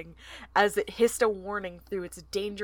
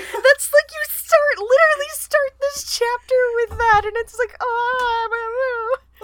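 gushing over cuteness; 14 semitones higher, 7 dB louder than usual. Real, not acted. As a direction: squeal with delight, stretching the words. Gushing over the cute song.

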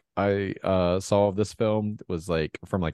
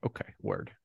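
deadpan aside; 10 semitones lower, 9 dB quieter than usual. Retold or imitated. Flat retold reply.

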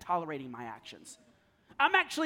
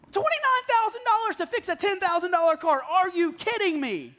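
much higher, louder, incredulous outburst; outraged disbelief; 9 semitones higher, 8 dB louder than usual. Retold or imitated. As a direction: let the outrage burst out, slowing on the last line. Outraged at repair price.

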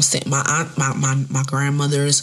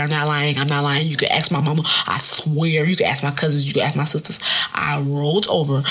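ordinary talk, nothing marked

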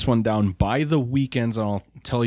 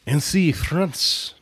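higher-pitched, slow attempt at a French accent; hammed-up French accent, pitched up and drawn out; 7 semitones higher, 3 dB louder, slower than usual. Shown as a demonstration. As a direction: put on an amateur French accent. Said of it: "try with a French accent".

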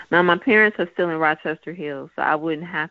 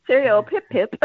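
high, loud posh British toff; mock British cheerio; stuffy British accent; 6 semitones higher, 4 dB louder than usual. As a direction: chirp it like an upper-class Englishman.

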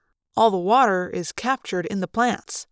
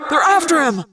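called down; 8 semitones higher, 6 dB louder than usual. Acted. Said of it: "Tobias called down from above".

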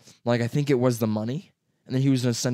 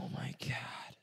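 whisper; 17 dB quieter, slower than usual. Real, not acted. Reacting to lost ID.

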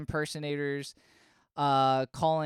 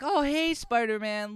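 high, louder hello to a pal; chummy phone greeting; 7 semitones higher, 4 dB louder than usual. Acted. Howard phoning Spider-Man for a favor, put-on chummy greeting. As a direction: greet them with put-on brightness.

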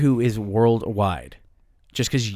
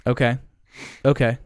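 normal talking voice

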